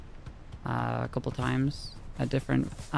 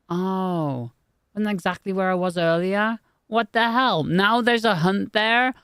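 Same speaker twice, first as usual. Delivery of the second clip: higher, louder, baffled complaint; exasperated outburst; 7 semitones higher, 5 dB louder than usual. Real, not acted. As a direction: rant at the game in disbelief. Gamer annoyed at map marker.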